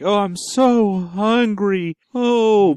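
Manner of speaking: drawn-out hungry moan; slow, eerie moan from the monster; slow moaning creature. Pretend. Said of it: "So it just starts going like".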